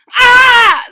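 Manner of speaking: very high, very loud startled scream. Acted. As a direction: shriek as you're caught off guard. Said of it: "screamed Francine".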